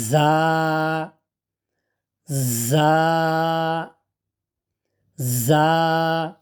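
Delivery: জা with a buzzing "z" and held vowel; tongue tip to the upper gum, buzz into জা. Shown as a demonstration. Teacher drilling the letter, জা as a buzzed, held "zaa". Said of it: "মাথা উপরের দাঁতের মাড়ির সাথে লাগিয়ে".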